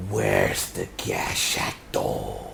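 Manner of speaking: slow, low whispered question; low menacing ask. Acted. Villain asking for the Gashato, slow and low.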